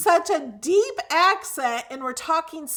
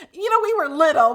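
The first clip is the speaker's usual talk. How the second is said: ordinary talk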